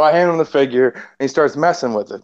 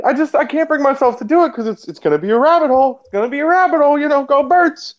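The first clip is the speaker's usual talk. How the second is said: much higher put-on voice for the buddy; mock friend voice; 11 semitones higher than usual. Quoting friend refusing the figure, higher voice. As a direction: squeak it up like the friend. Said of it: "And he's like".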